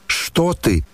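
high-pitched startled question; alarmed cry. A narrator's reading. A mother tries to stop her son, crying out. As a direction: cry it out high, without volume. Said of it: "крикнула Нина Александровна".

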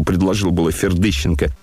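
ordinary delivery

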